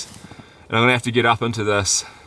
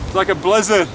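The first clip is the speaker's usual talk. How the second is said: raised voice over wind; 11 semitones higher, 3 dB louder than usual. Real, not acted. Outside in blizzard, wind.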